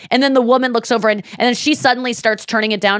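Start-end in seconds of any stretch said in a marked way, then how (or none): none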